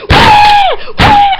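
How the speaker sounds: put-on very high, loud baby "wah"; shrieked imitation of a bawling child; screeching fake wail; mock tantrum wail. Imitates adult crying like child.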